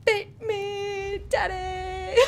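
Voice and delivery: squealing high, half-crying; high mock wail. Mock cry while gaming.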